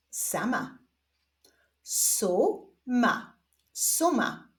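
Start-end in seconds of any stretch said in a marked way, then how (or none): 1.8-3.4 stretched first syllable, pause, then second; drawn-out, divided syllables; syllable reading. Shown as a demonstration. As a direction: read it slowly as two separate syllables. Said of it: "zapraszam Was do odczytywania sylab".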